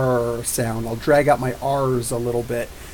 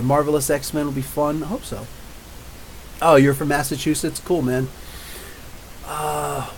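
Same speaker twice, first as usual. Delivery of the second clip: usual speaking voice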